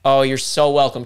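ordinary talk

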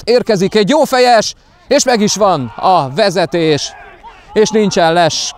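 rising, high goal call; excited hungarian sports commentary. Real, not acted. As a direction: shout the goal as it happens. Goal called live.

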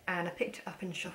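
ordinary delivery

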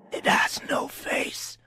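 slow, airless creature whisper; dark whisper behind you. A narrator's reading. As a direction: whisper it to yourself, slowly. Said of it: "the thing whispered to itself".

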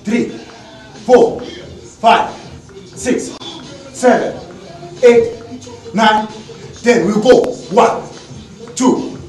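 fitness coach counting. Acted. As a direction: call out reps to the class.

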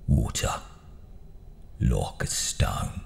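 low, croaky Brummy growl; a gruff Birmingham gangster's murmur; Arthur Shelby. Acted. Gangster muttering in a low Brummy voice.

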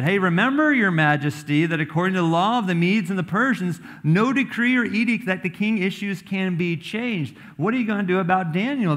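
high, loud, pushy courtiers pressing the king; scheming accusers. Acted. Courtiers corner King Darius over Daniel, pushy and high. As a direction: needle the king, fast and high.

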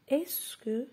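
"est-ce que" said high and bright; highlighted phrase. Shown as a demonstration. Teacher stressing "est-ce que" at the start, high. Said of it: "j'ai commencé la phrase avec la formule est-ce que".

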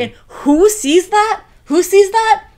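high, loud, drawn-out teasing question; dramatic teaser. Real, not acted. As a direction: build suspense like a gossip reveal. Dramatic build-up to a reveal.

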